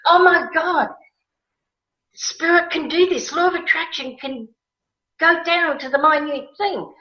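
high, breathless with wonder; amazed wonder. Retold or imitated. To Lynn about the spices, in high amazed wonder. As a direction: marvel at it, almost disbelieving.

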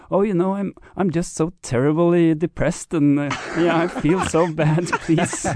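cheerful sing-song moaning about depression; depressed Norwegian. Retold or imitated. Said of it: "a depressed Norwegian goes to the psychologist".

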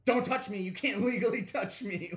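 loud mock yell; screaming student. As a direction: shout it like a furious kid. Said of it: "there would be screams of".

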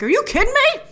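high, incredulous rising question; exasperated disbelief. Retold or imitated. Viewer reacting to bad decisions, high and incredulous. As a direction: call out the characters' stupidity.

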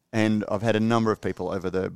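ordinary talk, nothing marked

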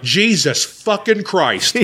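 slow exasperated plea; exasperated inner voice. Retold or imitated. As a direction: drag out every word, fed up. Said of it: "in my head, I'm like".

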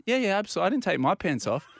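high-pitched, earnest insistence; insistent protest. Retold or imitated. Patient insisting to questioning doctor.

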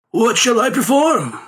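lightly voiced performer asking; performer's question. A narrator's reading. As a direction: ask it like a street conjurer ready to please. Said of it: "said the man".

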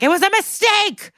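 high-pitched blurted confession; cracking under questioning, voice shooting way up; exasperated outburst. Retold or imitated. Cornered witness finally admits it to the lawyer, high exasperated outburst.

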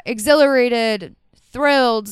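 loud and thrilled; bursting with giddy energy; excited. Real, not acted. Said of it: "if I sound crazy".

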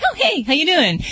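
a light, lightly voiced friendly dog greeting. Boss the dog greeting the anxious neighbor, lightly voiced friendly hello. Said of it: "Boss looks at her like".

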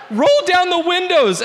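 high, loud panicked scream. Retold or imitated. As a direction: scream it again in terror. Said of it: "as I screamed".

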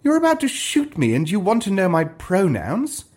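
incredulous. A narrator's reading. Stranger at crossbow point, incredulous.

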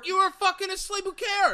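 high, loud incredulous yell; exasperated outburst. Real, not acted. Co-host berating people who deny sleeping, exasperated shouting. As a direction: blow up at the silly excuse.

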